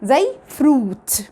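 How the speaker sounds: "fruit" said very slowly with a long oo; slow word demo. Shown as a demonstration. Teacher models the example word, very slowly. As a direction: stretch the oo in "fruit". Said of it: "بنطقه لونج أو".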